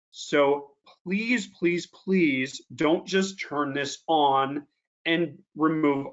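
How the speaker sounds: slow, carefully spaced words for a warning; slow right down so every phrase lands; measured, emphatic instruction with pauses between phrases; deliberately slow. Real, not acted. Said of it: "I'm gonna slow down on this one".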